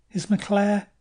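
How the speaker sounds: a sudden rush of worry; anxious question. Acted. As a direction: ask fearing the answer. Anxious question after rescue.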